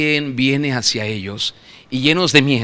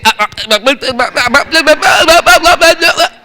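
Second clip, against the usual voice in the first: stammered ahs climbing higher and louder; panicked babbling; 9 semitones higher, 4 dB louder than usual. Shown as a demonstration. Frightened man babbling in tongues from fear. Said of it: "porque dice".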